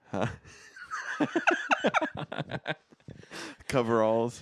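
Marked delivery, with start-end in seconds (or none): 0.8-2.7 high bursting laugh. Real, not acted. Laughs at the quick reply.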